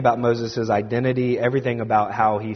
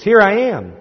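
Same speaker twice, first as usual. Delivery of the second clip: high, loud eager reply; affirmative, enthusiastic; 9 semitones higher, 7 dB louder than usual. Retold or imitated. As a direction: answer the call keenly, voice leaping up. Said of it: "responds somewhat affirmatively and enthusiastically".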